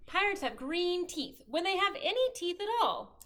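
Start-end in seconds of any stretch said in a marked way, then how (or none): none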